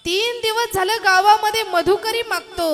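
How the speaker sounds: put-on pleading child. Child asking hungry mother.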